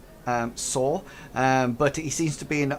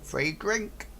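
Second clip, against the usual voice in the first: goblin quip; 6 semitones higher, 6 dB quieter than usual. Acted. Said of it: "And he goes".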